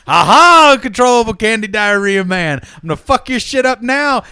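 high, loud gloating "aha"; hammed-up bad guy threat; villainous bomber. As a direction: taunt the hero like a cartoon villain. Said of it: "and the bomber's like".

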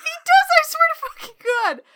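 high, loud, excited vow; emphatic insistence. Real, not acted. Insisting to cohost it's really in the film, loud and excited. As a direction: swear to it at the top of your voice.

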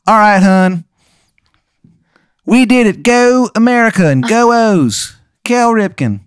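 high, hammed-up Maryland accent as Washington; atrocious Maryland accent. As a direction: overdo the Maryland accent, cheering the O's. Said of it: "have these atrocious Maryland accents".

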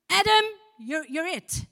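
hammed-up loud God declaring; mock booming God. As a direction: bellow it like a grand decree. God voiced as shouting a command.

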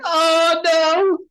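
high, loud comic "oh no"; mock dread. Patient walking into the dentist's, hammed-up dread.

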